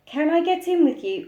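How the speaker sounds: put-on high pleading toddler; toddler's voice. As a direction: ask like a tiny child. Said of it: "asked the little one".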